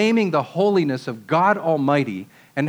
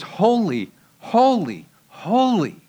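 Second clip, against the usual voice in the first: HOLY, HOLY, HOLY, each one rising higher and set apart; emphatic repetition; 6 semitones higher, slower than usual. Shown as a demonstration. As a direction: hit each HOLY separately, lifting the last two. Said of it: "Repeated for emphasis".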